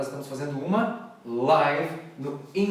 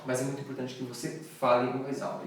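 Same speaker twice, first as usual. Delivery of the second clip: ordinary delivery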